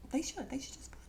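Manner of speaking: conspiratorial whisper; whispered aside. Acted. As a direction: hush it like a naughty secret. Repeats line as hushed aside.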